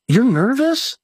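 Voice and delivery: high-pitched incredulous echo question; can't believe what you just heard; retold disbelief. Acts out his disbelief at the admission.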